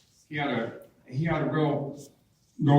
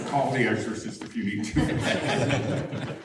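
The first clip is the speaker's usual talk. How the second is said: laughing joke; slower than usual. Real, not acted.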